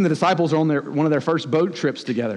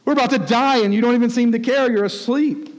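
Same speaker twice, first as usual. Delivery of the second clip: higher-pitched pleading cry, only mildly yelled; acted panicked yell; 7 semitones higher than usual. Peter to Jesus in the storm, mildly yelled alarm. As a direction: play an exasperated appeal to a sleeper. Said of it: "Peter yells out".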